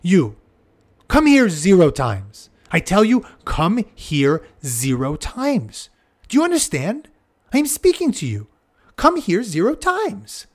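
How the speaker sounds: a hammed-up stern warning to one child; mock severe. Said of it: "address one of them severely, even threateningly".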